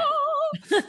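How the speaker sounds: very high sustained "oh"; projected high note. Shown as a demonstration. Former singer proving she can project, high call. Demonstrates projecting voice after boast.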